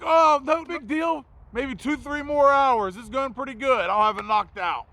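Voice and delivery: hammed-up no-worries act; fake breezy reassurance. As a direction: sell it like everything's fine. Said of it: "I was like".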